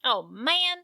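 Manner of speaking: ordinary talk, nothing marked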